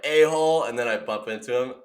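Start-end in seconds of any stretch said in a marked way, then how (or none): 0.0-0.6 "A-hole" thrown out high and loud; mock yelled insult. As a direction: hurl "A-hole" at the kid. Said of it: "and I'm like".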